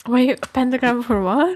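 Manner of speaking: loud surprised question. Real, not acted. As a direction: blurt it out in surprise. Surprised reaction.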